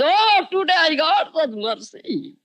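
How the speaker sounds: high, drawn-out ecstatic exclamation. Retold or imitated. Kicked Shivananda dances, rejoicing in God's mercy, ecstatic. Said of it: "danced with ecstasy".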